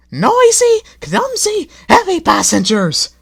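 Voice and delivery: very high, loud shrieking coaches. A narrator's reading. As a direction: screech out every complaint. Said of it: "shrieked the coaches".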